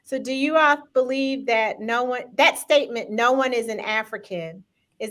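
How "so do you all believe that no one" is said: ordinary talk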